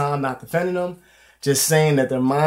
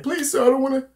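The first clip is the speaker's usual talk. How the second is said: high-pitched mock begging; retold tearful pleading in a high voice; imitated crying plea; 12 semitones higher than usual. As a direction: beg like a crying kid, voice way up. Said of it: "He's crying".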